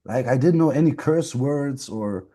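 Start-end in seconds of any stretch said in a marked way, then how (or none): none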